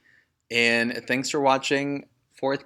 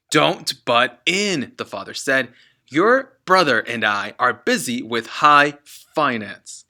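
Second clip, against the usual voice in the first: slow, loud scolding; father cutting off Matilda; dismissive; 7 dB louder, slower than usual. Acted. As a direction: brush the girl off sharply.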